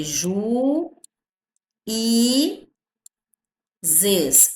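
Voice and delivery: "ju", "i", "zes" with long pauses between; slow split of "ju, i, zes", "i" standing by itself; syllable by syllable. Shown as a demonstration. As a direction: stretch "ju" and "i", each a syllable alone. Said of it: "Ele está sozinho na sílaba".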